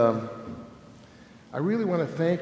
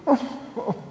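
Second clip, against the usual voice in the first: mock teary oh; 10 semitones higher than usual. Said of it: "I think he's gonna cry".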